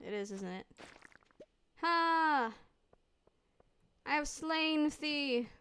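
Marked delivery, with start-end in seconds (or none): 1.8-5.5 slow, drawn-out theatrical triumph; mock heroic. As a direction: play a hammy Shakespearean hero gloating over a foe. Mock heroic taunt at slain monster.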